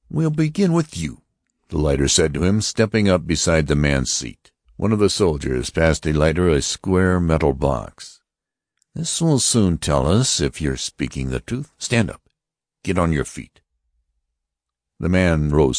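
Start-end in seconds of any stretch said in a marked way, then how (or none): 0.1-1.2 high, stern voice of an alien officer; Martian officer. Acted. Martian officer warning the passengers, stern and high. Lighter picks the businessman. 8.9-13.6 Martian officer. Acted. Lighter orders man to stand.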